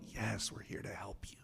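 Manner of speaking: hushed, gentle reassurance; calm him down in a near whisper; soothing whisper. Retold or imitated. Agent whispers comfort to the sobbing suspect.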